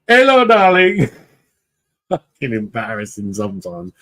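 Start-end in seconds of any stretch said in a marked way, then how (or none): 0.1-1.1 put-on very high, loud, slow Indian accent hello; shopkeeper's Indian accent. Shopkeeper greeting every visit, high Indian accent. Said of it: "he went".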